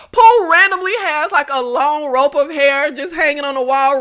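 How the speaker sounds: ordinary talk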